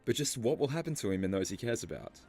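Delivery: ordinary talk, nothing marked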